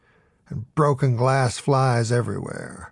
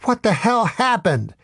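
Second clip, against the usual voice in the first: higher, half-shouted question; mock shout; 9 semitones higher than usual. A narrator's reading. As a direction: blurt it out in dismay. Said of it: "I shout to no one and everyone".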